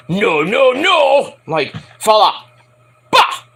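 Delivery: put-on old catchphrase. Recapping a veteran's saying, mock protesting outburst. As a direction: ham up the old guy's outburst. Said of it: "He used to say".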